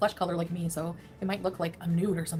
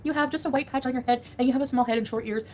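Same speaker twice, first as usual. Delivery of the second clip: pet talk; 6 semitones higher, 4 dB louder than usual. Real, not acted. Talking sweetly to her cat.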